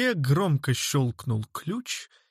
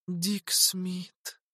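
put-on soft girl voice; 6 semitones higher, 5 dB quieter than usual. A narrator's reading. Zerlina answers the guard.

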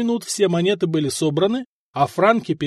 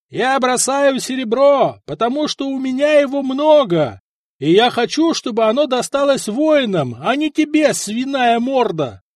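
shouted taunt; 8 semitones higher, 4 dB louder than usual. A narrator's reading. Vyshata on the wall insulting the duke before soldiers, shouting. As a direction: shout it down for all to hear.

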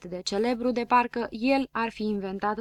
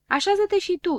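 eager reply; 9 semitones higher, 5 dB louder than usual. A narrator's reading. Piticii îi răspund lui Limonadă.

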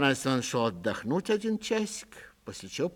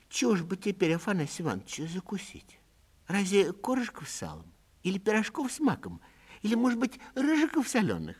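lightly voiced old wife; 9 semitones higher than usual. Old wife offering her husband snacks, lightly voiced and high. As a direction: offer treats one by one, eager to please.

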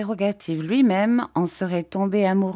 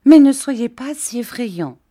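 put-on higher-pitched girlish plea; lightly voiced coaxing young fiancée; childlike Dora, pleading; 7 semitones higher, 7 dB louder than usual. A narrator's reading.

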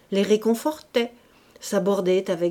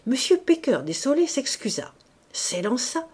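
ordinary delivery

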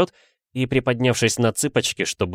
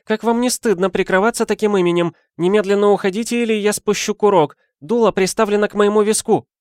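high, loud, fast hysterical woman; an overwrought lady shrieking through the door; 12 semitones higher, 4 dB louder, faster than usual. Acted. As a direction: rattle it off in a high panicky threat. Lady threatens the intruder.

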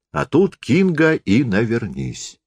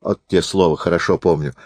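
ordinary delivery

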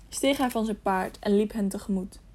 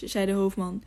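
ordinary delivery

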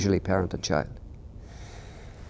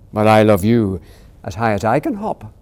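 smiling big hare; 8 dB louder than usual. Acted. Big hare outhopping his son, fond smiling reply. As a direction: say it with a smile. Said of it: "smiled Big Nut Brown Hare".

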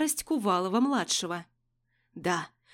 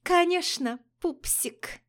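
мило пропела; 8 semitones higher, slower than usual. Acted. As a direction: coo it sweetly, teasing. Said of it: "и мило пропела". (Алина мужчине, мило нараспев)

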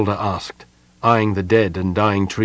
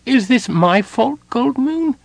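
high, pleading and mournful; slower than usual. A narrator's reading. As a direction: ask it sadly, almost in tears. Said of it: "Tas asked mournfully".

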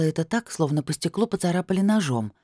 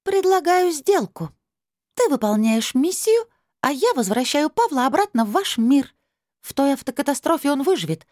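high, louder otherworldly negotiator; hard crystal voice; 11 semitones higher, 4 dB louder than usual. A narrator's reading. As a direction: lay out a deal firmly, pitched up. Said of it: "Голос стал жёстким". (высоким жёстким голосом)

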